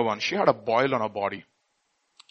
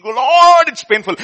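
mock scream; 12 semitones higher, 16 dB louder, slower than usual. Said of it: "you're screaming and you're saying".

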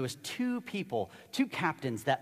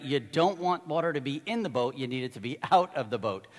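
usual speaking voice

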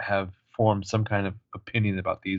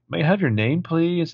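prim receptionist; 7 semitones higher than usual. Retold or imitated. Assistant voice again.

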